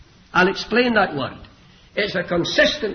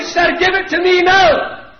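put-on high, loud cry from an eager boy; urgent pleading cry; 14 semitones higher, 12 dB louder, slower than usual. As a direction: beg for it at full voice. Boy begs to take the flogging.

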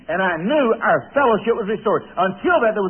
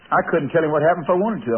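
ordinary talk

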